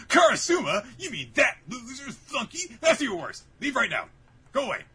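high, loud indignant yell; outraged outburst. Retold or imitated. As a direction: act out an offended, dismissive tirade. Acted outburst at a lowly visitor.

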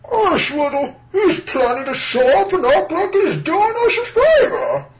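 very high, loud put-on voice; Marlon Brando. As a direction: ham up a strained high voice. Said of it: "your Marlon Brando's way too good".

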